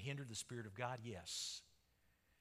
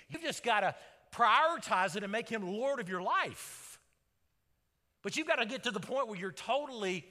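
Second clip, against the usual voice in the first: louder, driving preacher's cadence; preaching at full force; 6 semitones higher, 7 dB louder than usual. Acted. Pastor urging the congregation, full preaching force. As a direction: press the point home to the congregation.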